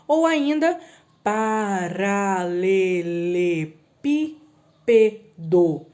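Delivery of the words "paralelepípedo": paralelepípedo very slow, each syllable separate; syllables drawn out. Shown as a demonstration. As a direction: tap out every syllable of paralelepípedo. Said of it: "Sete sílabas". (paralelepípedo bem devagar, sílaba por sílaba)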